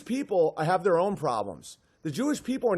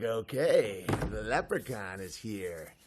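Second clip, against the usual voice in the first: low mock calm; 9 semitones lower, 8 dB quieter than usual. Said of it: "And you're like".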